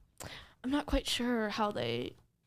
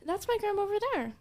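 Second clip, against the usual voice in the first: quick, higher in-character claim; pointing out grandma; 10 semitones higher, much faster than usual. Acted. Player points the officers to a pretend grandma, quick cover story. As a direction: rattle it off high, covering for yourself. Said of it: "I'm gonna point over".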